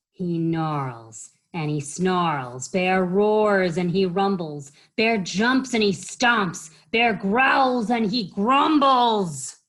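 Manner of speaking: slow start building to loud rumbling; dramatic story narration. A narrator's reading. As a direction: pound out each bear verb.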